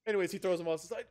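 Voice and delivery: ordinary talk, nothing marked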